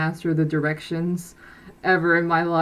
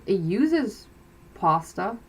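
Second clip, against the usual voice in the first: ordinary talk, nothing marked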